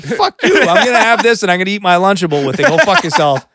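high, smug taunting brag; cocky kid at lunch. Retold or imitated. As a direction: gloat over the better lunch. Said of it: "And it's like".

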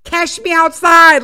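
loud, slow quoted catchphrase; Cash me outside girl. Retold or imitated. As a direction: belt the meme line slowly. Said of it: "like that girl says".

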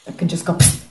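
a quick hissing spark sound; whispered psst. Shown as a demonstration. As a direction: imitate a spark fizzing out. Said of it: "like this".

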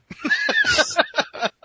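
sudden high laugh. Real, not acted. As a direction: burst out laughing. Laugh at debt joke.

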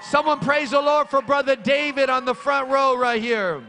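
loud, high, fervent preacher's cry; shouting praise from the pulpit; full-force preaching. Acted. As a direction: preach it out to the whole church. Preacher exhorting congregation.

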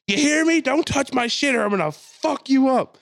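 high, threatening mock yell; imitated screaming. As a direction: warn off a stranger as the screaming woman. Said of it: "She's screaming at the door".

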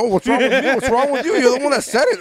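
high-pitched mock indignation, repeating himself; acted indignant protest. Husband retelling a spat with his wife, mock indignant. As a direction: throw the accusation back, pitched well up. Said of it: "And I go".

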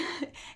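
brief laugh mid-sentence. Real, not acted. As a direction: let a short laugh slip out. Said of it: "I'm laughing and I shouldn't".

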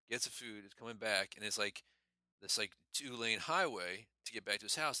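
ordinary talk, nothing marked

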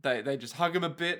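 usual speaking voice

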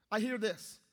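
ordinary delivery

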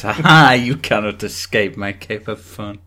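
loud laugh into a crab villain line; mock crab villain, loud opener; crab accent. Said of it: "Is that your crab accent?".

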